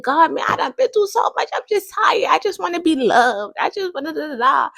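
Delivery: high, pleading complaint to God; a hammed-up tired plea; mock weary lament. Vlogger retelling her weary prayer to God, high pleading whine. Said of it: "I'm like".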